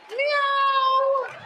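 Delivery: high cute meow; cat meow. Shown as a demonstration. Comedian meows to play the Persian cat.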